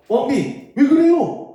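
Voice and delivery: startled by the queen's tears; alarmed king. Acted. (높고 크게 당황한 왕)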